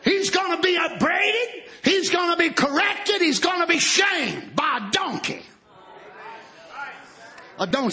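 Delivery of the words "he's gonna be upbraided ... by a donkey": high, driving pulpit delivery; full-force preaching. Real, not acted. Preacher warning of humbling by a donkey, forceful preaching. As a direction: hammer each repeated phrase at raised pitch.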